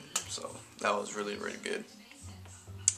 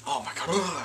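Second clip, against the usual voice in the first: frustrated groan; 5 semitones higher than usual. Real, not acted. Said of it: "I cannot speak for some reason".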